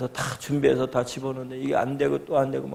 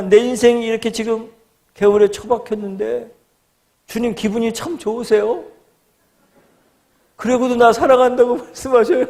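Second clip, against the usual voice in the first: high, loud protest to God; retold bitter complaint, pitched up and sarcastic; resentful prayer; 8 semitones higher, 5 dB louder than usual. Young man railing at God from the ditch, resentful. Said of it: "원망 아닌 원망 섞인 기도를".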